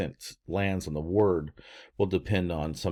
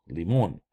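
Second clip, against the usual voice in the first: "limon" said lee-MON; stress on O. Shown as a demonstration. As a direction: land on the full oh in "limon". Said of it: "therefore we say O".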